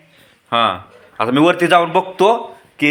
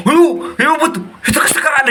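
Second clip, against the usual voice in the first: higher, half-laughing mock yelp; hammed-up fright; 10 semitones higher than usual. Father sees the pretend ghost on the stairs, hammed-up fright. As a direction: play scared but let the laugh slip through.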